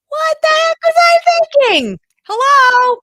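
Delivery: high, loud, self-mocking; incredulous outburst. Real, not acted. Homeschool mom scolding her past self, high and loud. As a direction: shout at your past self in disbelief. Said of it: "self-mocking outburst".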